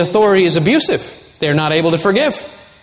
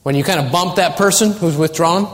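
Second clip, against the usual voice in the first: ordinary delivery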